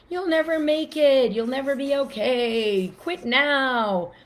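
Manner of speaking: high, loud, taunting critic; a harsh inner critic tearing you down; negative inner voice. Retold or imitated. Voicing the negative inner messages.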